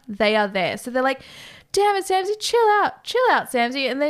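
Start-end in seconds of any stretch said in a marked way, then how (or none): 1.7-3.9 raised-pitch plea, easing down at the end; mock telling-off. Bros calming Samsy in the retelling, mildly exasperated. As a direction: imitate a bro telling a friend to settle. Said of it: "telling anyone to calm down".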